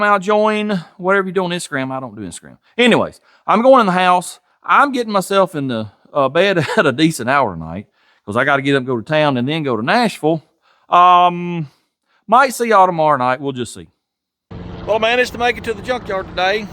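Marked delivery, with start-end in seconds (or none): none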